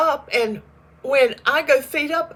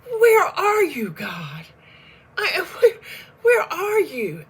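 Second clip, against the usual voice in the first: higher, slow, pleading where are you; crying out to God in despair; anguished cry to God; 5 semitones higher, slower than usual. Retold or imitated. Scene: woman hearing cancer news cries out to God, anguished. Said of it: "And I thought".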